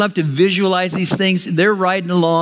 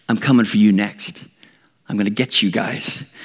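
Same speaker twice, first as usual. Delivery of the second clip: mock murderous threat; 9 semitones lower than usual. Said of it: "breathing out murderous threats".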